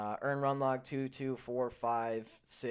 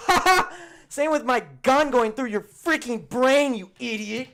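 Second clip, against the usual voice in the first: much higher, much louder, slow unhinged laugh-threat; cartoonish fury, ham it up; hammed-up crazed "Ha ha!" then the threat; manic mock rage; 14 semitones higher, 18 dB louder than usual. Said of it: "I'm like".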